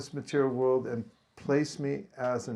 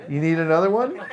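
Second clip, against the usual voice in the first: laughing; 4 semitones higher, 10 dB louder than usual. Real, not acted. Teasing crowd after the shout.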